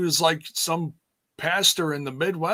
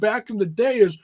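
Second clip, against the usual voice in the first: ordinary talk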